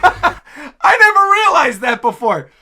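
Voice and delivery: high, loud, drawn-out amazement; real laughing astonishment; laughing in disbelief. Real, not acted. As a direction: crack up while marveling at a discovery. Laughing at a sudden discovery.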